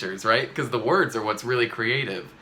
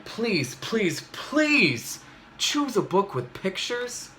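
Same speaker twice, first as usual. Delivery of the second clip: mock desperate begging; pleading; 7 semitones higher, slower than usual. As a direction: plead with drawn-out pleases. Reader begging.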